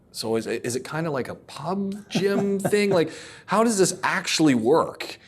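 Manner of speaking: high, half-laughing disbelief; baffled retold query; incredulous question. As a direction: raise the pitch like you can't believe it. Quizzing Kev about the gym.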